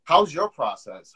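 usual speaking voice

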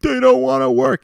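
high, loud mocking whine; mock boomer whine. Comic mocking elders griping about youth, high loud whine.

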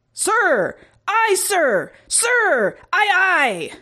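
high, quick-fire crew responses; obedient crew. A narrator's reading. Crew answering commander's orders, obedient. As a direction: acknowledge orders in high exclamations.

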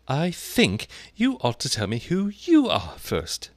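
high, slow and grave. A narrator's reading. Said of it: "very gravely".

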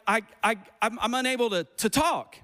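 acted stammer with repeated starts; tongue-tied stammering. Pastor retelling his shyness at the gym, tongue-tied stammering. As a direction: imitate yourself stumbling over the words. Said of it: "all of a sudden I get like".

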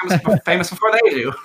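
laughing. Real, not acted. Podcaster laughing at a friend's fame, breaking into giggles. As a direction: laugh your way through the joke. Joking about a friend getting famous.

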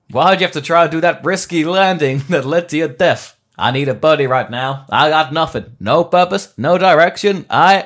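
loud, self-pitying American test pilot; Matthew McConaughey. Acted. Retired pilot mourning his dead buddy, McConaughey type. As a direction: lament in a put-on American voice. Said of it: "A Matthew McConaughey type".

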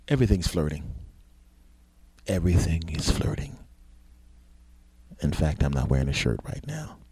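hammed-up bedroom voice; mock seductive. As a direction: drop deep and linger, playfully seductive. Mock-seductive sign-off to the listeners.

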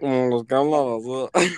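mock nasal speech impediment with a laugh; nasal gangoso. Shown as a demonstration. Friend shows how gangosos talk, mock nasal garble breaking into laughter. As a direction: talk it through the nose, muddying the consonants. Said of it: "cómo es andar gangoso".

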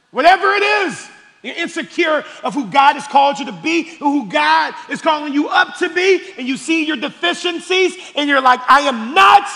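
loud, high, full-force preacher; preaching. Real, not acted. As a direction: preach it hard and lift your voice.

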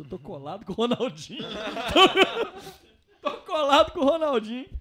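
high, loud, laughing through the words; laughing boast. Retold or imitated. Laughing, bragging to the other travelers about being close to Ronaldinho. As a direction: crack up while bragging about his new buddy. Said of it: "todo mundo já olhou pra mim".

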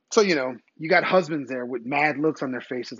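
ordinary talk, nothing marked

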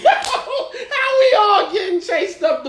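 very high, slow comic wail; mock disbelief. Kid chased with his brother, high-pitched mock disbelief. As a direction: squeal it in drawn-out disbelief. Voices his own bewildered outcry.

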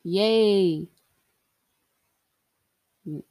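ordinary delivery

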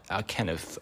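ordinary talk

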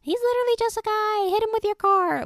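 squeaky high, loud inner-voice rant; cartoonish high mock outrage. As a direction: pitch it way up like a scandalized best friend. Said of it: "I was like".

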